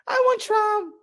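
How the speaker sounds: hammed-up impression of the takeout cashier; imitating the Chinese restaurant lady. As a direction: put on a high little voice for the shop owner. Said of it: "la china me dijo".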